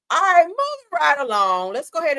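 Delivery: high, loud hype host delivery; mock announcer voice. Vlogger mocking a presenter, hammed-up host voice. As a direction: sing it out like a showman. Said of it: "Ain't that how you sound?".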